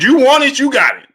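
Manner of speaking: you want it yelled high and loud, then dropping; hyped shout. Retold or imitated. Streamer shouts the fighter's challenge, hyped. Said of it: "Rocky said".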